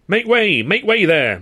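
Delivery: loud, high bellow clearing a path; guard bellowing at the crowd to make way. A narrator's reading. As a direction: bellow it like a guard shoving through. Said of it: "Mike bellowed".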